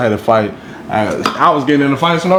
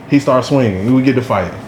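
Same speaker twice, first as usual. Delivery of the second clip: normal talking voice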